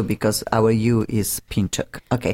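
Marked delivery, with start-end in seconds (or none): 1.5-2.0 Pinchuk the Polish way, with an "oo" for the U; Polish pronunciation. Shown as a demonstration. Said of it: "that would be Polish version too".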